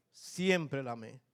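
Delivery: slow, soft and heartfelt; lento y sentido. Real, not acted. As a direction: say it slowly, meaning every word. Repite más lento y suave. (despacio y con ternura)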